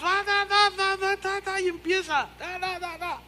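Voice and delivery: high, rapid babbling in tongues; high mock tongues babble; mock speaking in tongues. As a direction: imitate a woman caught up in tongues. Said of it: "empieza a profetizar en lenguas".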